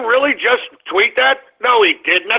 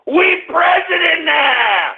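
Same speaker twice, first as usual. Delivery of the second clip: high, drawn-out victory yell; triumphant shout; 8 semitones higher, slower than usual. Real, not acted. Host whoops over election win.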